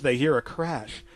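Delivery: usual speaking voice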